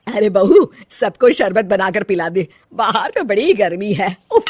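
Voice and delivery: bossy mother-in-law. Acted. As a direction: order the daughter-in-law around. Mother-in-law orders the bahu. (रौबदार सास की आवाज़)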